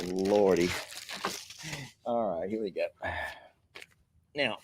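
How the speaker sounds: normal talking voice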